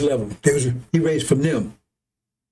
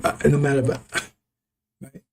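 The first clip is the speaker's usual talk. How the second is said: ordinary delivery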